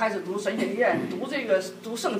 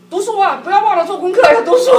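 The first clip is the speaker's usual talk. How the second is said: loud and much higher, rising to a near shriek; nagging order; 9 semitones higher, 11 dB louder than usual. Retold or imitated. Wife pushing husband to study at the resort, loud nagging. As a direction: hound him to hit the books.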